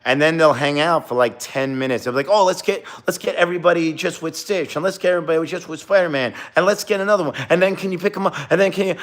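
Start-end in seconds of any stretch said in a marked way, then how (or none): 2.3-8.9 higher, pushy tourist mimicry; demanding tourist. Performer mimicking tourists who keep asking for more photos, in a high, pushy voice. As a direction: rattle off requests like a pushy parent.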